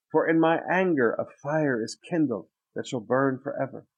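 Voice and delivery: loud, measured scripture reading from the pulpit. Acted. Preacher reads Jeremiah's lament to the church, declaiming. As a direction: proclaim the prophet's words to the congregation.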